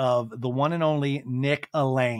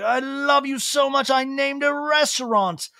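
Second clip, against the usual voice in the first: high-pitched, gushing put-on voice; mock adoration, high with halting fillers; Lisa Vanderpump; 5 semitones higher than usual. Lisa telling the wolf of her love, gushing impression. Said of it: "And Lisa's like".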